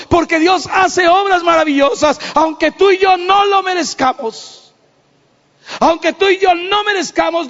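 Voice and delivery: high, shouted praise of God's mercy; preaching at full force. Acted.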